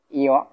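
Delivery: teacher's top-to-bottom tone on "ইয়"; falling tone. Shown as a demonstration. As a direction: say "ইয়" as an isolated falling syllable. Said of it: "উপর থেকে নিচে".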